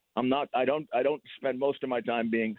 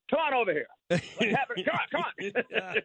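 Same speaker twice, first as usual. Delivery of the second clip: higher-pitched laughing dare, drawn out at the end; taunting challenge; 7 semitones higher, slower than usual. Retold or imitated. Taunting a cursing guy outside a party, laughing dare. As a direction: goad the yelling guy over, chuckling. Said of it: "this guy starts yelling at me".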